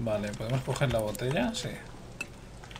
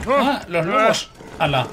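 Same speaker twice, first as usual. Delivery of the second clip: high, loud cry of alarm; startled yell; 8 semitones higher, 7 dB louder, slower than usual. Real, not acted. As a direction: yell out as danger suddenly jumps at you. Startled by attacking wolves in game.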